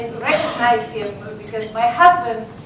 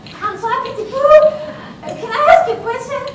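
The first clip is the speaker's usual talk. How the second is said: high, fast, eager little voice; eager student; 12 semitones higher, 5 dB louder, faster than usual. Retold or imitated. Student asking her teacher a question, eager. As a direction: ask like a keen pupil. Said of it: "So I said".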